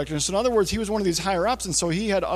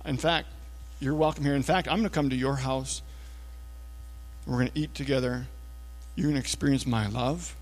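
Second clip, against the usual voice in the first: soft, low, unhurried reassurance; gentle Jesus; 4 semitones lower, 8 dB quieter than usual. Acted. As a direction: speak tenderly, pausing between each promise. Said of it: "what we have is Jesus saying".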